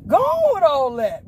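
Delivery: high, loud call to be left alone; loud protest. Real, not acted. Same protest, now called out.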